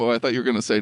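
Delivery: ordinary delivery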